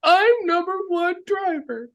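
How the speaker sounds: very high, put-on silly voice; mocking high-pitched impression; mock driver boast. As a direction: squeak out a childish brag, sustained and high.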